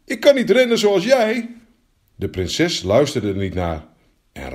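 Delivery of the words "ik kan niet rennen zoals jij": high, loud plea to be taken along; high-pitched frog wail; frog calling out. Acted. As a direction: cry out after them, high and desperate. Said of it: "riep de kikker".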